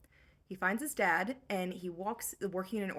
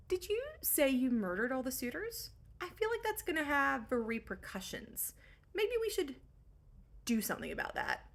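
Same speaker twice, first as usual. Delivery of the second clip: high, hesitant imitation of a worried dad; tentative mock concern; 5 semitones higher than usual. Father worries about the murdered suitors, tentative concern. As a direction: raise the pitch, pause before finishing the suggestion.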